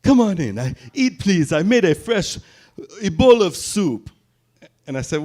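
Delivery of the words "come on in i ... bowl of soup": put-on high, lightly accented welcoming mother; a hint of an African mother's warm invitation; African mom accent. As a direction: invite him in with a higher African lilt. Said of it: "she's like".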